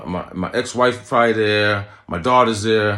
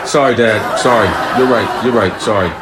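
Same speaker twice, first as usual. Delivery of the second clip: mock apology; 2 semitones higher, 9 dB louder than usual. Son's mock apology, loud.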